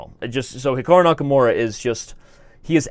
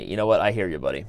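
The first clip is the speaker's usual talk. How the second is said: ordinary delivery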